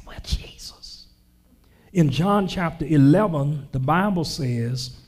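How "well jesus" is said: hushed whisper, no voice; a sheepish whisper to Jesus after being put in place. Acted. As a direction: whisper it like a secret aside. Comic aside to Jesus.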